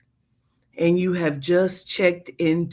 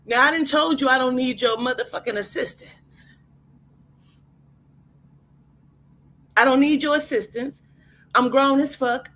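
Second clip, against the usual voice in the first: sassy tell-off; 6 semitones higher than usual. Acted. Guest telling off clerk.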